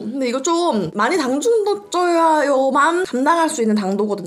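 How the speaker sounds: talking around sticky food; chewing while talking. Real, not acted. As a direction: mouth partly full. Seen 0: Bites s'more about 9.2s, then lips pushed forward while talking.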